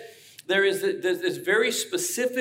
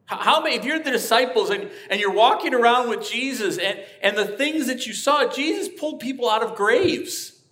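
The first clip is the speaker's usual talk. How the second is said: higher and louder, building excitement; rising preaching fervor; 6 semitones higher, 5 dB louder than usual. Real, not acted. Pastor stirring the church with loud, rising passion.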